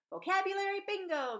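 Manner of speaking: high, loud game-show call; teacher announcing a class game with bright energy; excited announcement. A narrator's reading. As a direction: kick off the class game with a bang. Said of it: "Missus Gordon announced".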